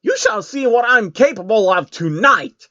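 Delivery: high, grand villainess voice; proclaiming queen. A narrator's reading. The queen proclaims to Soros before he leaves, high and grand. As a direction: announce your power like a proud queen. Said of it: "she proclaimed".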